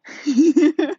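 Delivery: high, loud burst of laughter. Real, not acted. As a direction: laugh out loud at your own silly accent. Laughs at own accent attempt.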